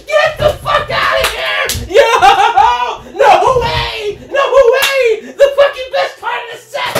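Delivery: high, loud shouts of disbelief; ecstatic screaming. Real, not acted. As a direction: yell like you just hit the jackpot. Pulls top card live.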